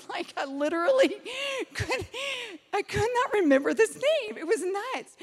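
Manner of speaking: high, laughing incredulity; amused exasperation. Real, not acted. Telling the audience about a forgotten name, laughing in exasperation. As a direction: laugh through it, pitched well up. Laughing at her own forgetfulness.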